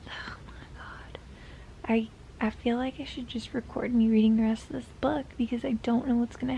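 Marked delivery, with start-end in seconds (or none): 0.1-1.2 breathless whispered oh my God; hushed disbelief. Real, not acted. Dramatisches Flüstern über das Buch.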